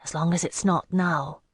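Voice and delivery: usual speaking voice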